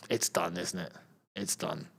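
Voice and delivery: low, soft, deflated sigh; resigned. Real, not acted. Streamer conceding Morocco's defeat, resigned and low. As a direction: give up on it quietly. Conceding his team is beaten.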